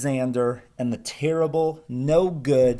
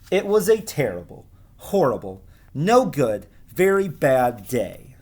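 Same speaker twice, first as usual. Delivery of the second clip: lively, higher storybook voice for the class; reading to children; 4 semitones higher than usual. Acted. Teacher reading Alexander to her class, lively storytelling voice. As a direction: read it like a picture book to kids. Teacher reads picture book aloud.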